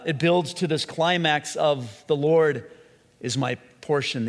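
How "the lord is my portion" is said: weight on Lord, pause after it; stress on Lord. Shown as a demonstration. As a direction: Lord hit loudest, then dropping away. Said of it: "the emphasis on the word Lord".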